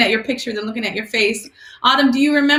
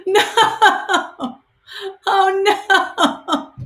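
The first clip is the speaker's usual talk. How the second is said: near tears; 6 semitones higher, much slower than usual. Real, not acted. Pastor missing the student she hoped to bless, near tears. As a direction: let the disappointment crack the voice.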